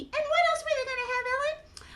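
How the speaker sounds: high, quick puppet voice; squeaky little character butting in; Mimi. Acted. Mimi asks the storyteller about the party, high puppet voice.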